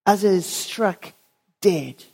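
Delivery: ordinary talk, nothing marked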